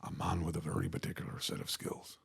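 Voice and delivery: low, quiet Northern Irish menace; Liam Neeson. Shown as a demonstration. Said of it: "I can do Liam Neeson".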